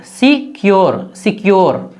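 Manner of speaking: "सिक्योर" spelled out slowly as si-kyor; slow teaching read of "सिक्योर"; slow syllable demo. Shown as a demonstration. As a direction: stretch "सिक्योर" into clear syllables si-ki-yor. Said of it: "हो जाएगा सिक्योर".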